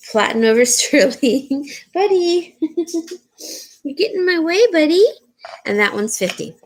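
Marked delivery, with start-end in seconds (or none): 1.9-5.1 higher playful scolding of a pet. Real, not acted. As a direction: tease the dog for getting in the way. Dog gets in the way.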